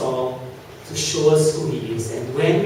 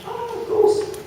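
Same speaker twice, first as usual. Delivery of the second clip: high, yelped cry of fright; a sudden panicked shriek; mock frightened cry. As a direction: yelp it like a scared disciple. Disciples cry out in fear.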